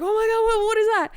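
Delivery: high, loud startled cry; retold shock. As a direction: blurt it out in alarm. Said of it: "I was like".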